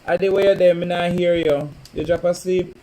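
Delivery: calling out. Real, not acted. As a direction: call out for Bobo. Calling out to viewer.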